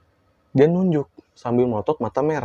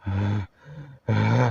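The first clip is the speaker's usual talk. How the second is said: possessed woman; 5 dB quieter than usual. Retold or imitated. Possessed woman groaning at the teller, imitated soft groans. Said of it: "kata ibu gitu".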